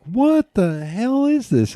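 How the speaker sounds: mock bewilderment, high and drawn out; baffled disbelief. Mexican friend reacting to piped-in cricket noises, baffled. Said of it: "he's sitting there like".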